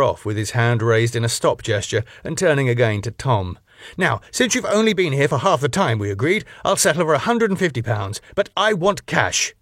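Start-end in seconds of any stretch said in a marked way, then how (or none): 4.0-9.5 high, fast, haughty demand; pompous club owner. Acted. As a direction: rattle off the bill coldly. Max voiced demanding money.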